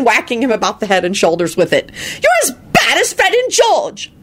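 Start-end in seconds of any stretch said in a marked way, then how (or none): 2.2-4.1 high, shrill scolding wife; exasperated scolding. A narrator's reading. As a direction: berate him in disbelief.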